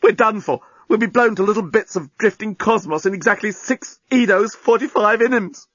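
high-pitched doomed lament; a touch of panic; despairing groan. A narrator's reading. Journalist facing the bomb without lifeboats, despairing. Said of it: "groaned the journalist".

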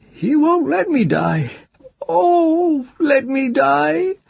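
a cursed hag crying to die; weeping old witch. Acted. Cursed witch weeps to the child, begging to die.

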